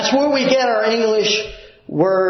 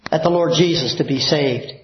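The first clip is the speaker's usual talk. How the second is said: ordinary talk, nothing marked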